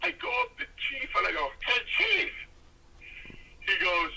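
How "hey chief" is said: high-pitched hail; imitated high call for attention. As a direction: call someone over in a retold scene. Calling out to Chief in story.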